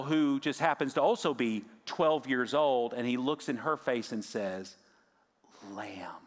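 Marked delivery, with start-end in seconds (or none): 5.7-6.2 softly whispered, much quieter; Jesus whispering to the little girl; tender whisper. Retold or imitated. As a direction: breathe it gently to the child. Jesus looks in her face.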